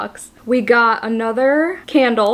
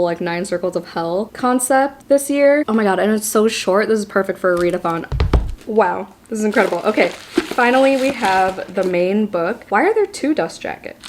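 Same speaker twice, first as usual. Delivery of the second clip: ordinary delivery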